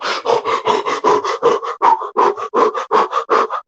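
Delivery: a crowd hyperventilating in fear; hyperventilating gasps. Retold or imitated. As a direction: gasp in and out like panicking. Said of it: "everybody's going to go".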